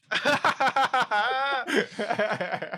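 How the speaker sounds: high, loud burst of laughter; helpless high cackle. Real, not acted. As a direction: crack up loudly. Cracks up at own accent.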